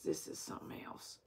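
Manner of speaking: whispered aside; whispered aside to herself about the screen. Real, not acted. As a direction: hush it like nobody should hear. Exasperated at the screen.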